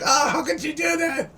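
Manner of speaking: high squealing mock outrage; hammed-up high-pitched protest; mock outraged wail. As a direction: squeal it like a scandalised fan. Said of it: "they're like".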